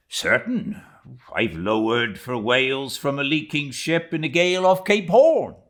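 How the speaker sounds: high, loud, offhand boast; Stubb, boastful sailor. Acted. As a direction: brush the question off proudly.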